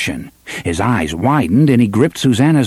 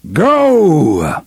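thundering; 6 semitones higher, 3 dB louder than usual. A narrator's reading. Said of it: "thundered the voice from the pipes".

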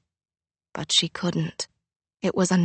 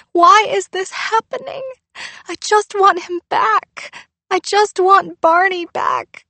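crying into chest; 11 semitones higher, 6 dB louder than usual. Acted. Girl cries into her father's chest. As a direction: cry it into his chest.

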